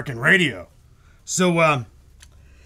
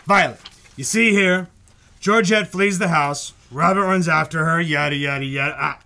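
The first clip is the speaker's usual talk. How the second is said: normal talking voice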